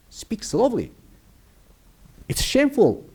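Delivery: softly, as if not to be overheard; mock hushed warning. Teacher telling students not to be heard calling someone liar, hushed. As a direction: keep it down in a pretend scolding. Don't make him or her hear you.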